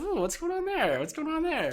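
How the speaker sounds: high-pitched exaggerated ooh, falling at each end; feigned curiosity. Retelling an awkward dodge of a question, feigned curiosity. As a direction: play up sudden interest in something else.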